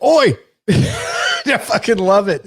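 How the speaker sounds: mock Cockney yell; Cockney accent. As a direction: shout it like a cheeky Cockney. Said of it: "you don't like a Cockney accent".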